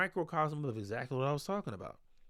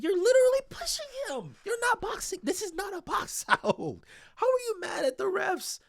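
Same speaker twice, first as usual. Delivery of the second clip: high, loud rant of disbelief; exasperated rant; 13 semitones higher, 3 dB louder than usual. Real, not acted. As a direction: yell at the player on screen in disbelief. Ranting at player's shoving foul.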